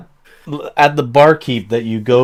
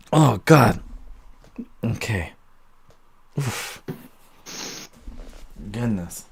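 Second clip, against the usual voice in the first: winded; 2 semitones lower, 8 dB quieter, slower than usual. Acted. Barkeep out of breath from the keg.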